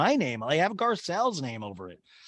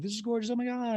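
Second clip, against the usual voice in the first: high, softer group gush; gushing girls; 10 semitones higher, 4 dB quieter than usual. Retold or imitated. Girls admiring Harry's table, high soft gushing. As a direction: coo it high and light. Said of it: "all the girls are like".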